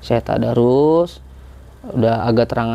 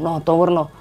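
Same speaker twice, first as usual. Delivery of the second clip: cheering friends on; 6 semitones higher than usual. Retold or imitated. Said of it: "gitu kan".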